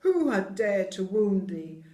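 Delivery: loud, outraged giant cry; angry cry. Acted. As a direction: cry out the demand. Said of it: "cried the giant".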